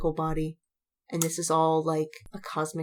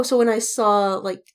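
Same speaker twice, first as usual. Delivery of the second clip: ordinary delivery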